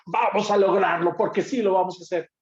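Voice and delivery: triumphant shout of we'll make it; victorious. Shown as a demonstration. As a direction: cheer the team on to the win. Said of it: "emociones incluso de victoria".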